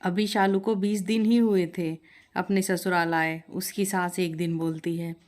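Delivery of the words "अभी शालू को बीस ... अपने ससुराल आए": usual speaking voice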